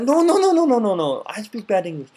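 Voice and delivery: shooting high, repeated no's; flustered denial. Retold or imitated. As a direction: wave off the praise in a flurry. Japanese person protesting a compliment.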